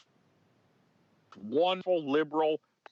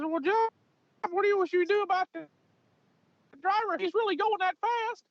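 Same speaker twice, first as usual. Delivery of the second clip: Mike Tyson voice. Retold or imitated. Prosecutor asking the officer, in a very high Tyson-like voice. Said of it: "but he talked like this".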